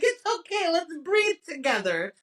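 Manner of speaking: high, coaxing therapist imitation; mock therapist. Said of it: "she's a therapist for the other woman".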